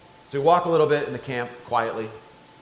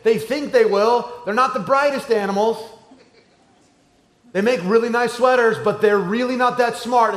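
higher, louder, lightly acted God; a touch of exasperated shepherd, raised voice; God resolved to rescue; 8 semitones higher, 5 dB louder than usual. Acts out God going after sheep.

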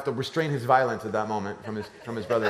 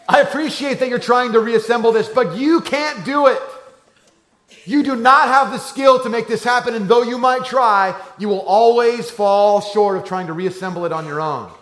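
condescending big brother; 8 semitones higher, 8 dB louder than usual. Retold or imitated. Oldest son explaining siblings can't fix it, mock patronizing. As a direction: talk down to the little ones like a know-it-all.

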